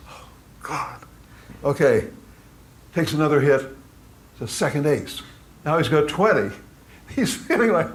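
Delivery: ordinary delivery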